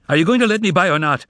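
fast, high, frantic yell; awful scream. Acted. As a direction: scream it at the attendants. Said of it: "screamed the poet in an awful voice".

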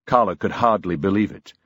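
ordinary delivery, nothing marked

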